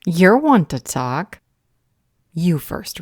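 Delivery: sharp retort. Acted. Retort to Rav.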